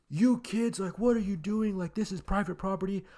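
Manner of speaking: high-pitched mock scolding; imitating the angry old guy. Angry homeowner scolding kids who trespassed, high mock scold. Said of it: "he gets out super angry".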